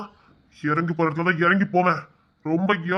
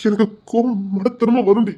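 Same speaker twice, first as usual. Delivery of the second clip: normal talking voice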